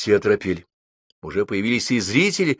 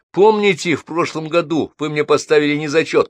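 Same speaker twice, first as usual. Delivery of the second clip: упрашивание; 8 semitones higher, 5 dB louder than usual. A narrator's reading. Студент упрашивает преподавателя.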